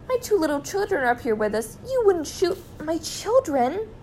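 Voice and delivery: high-voiced mother begging for her children; pleading cry. A narrator's reading. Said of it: "cried Missus Gregg".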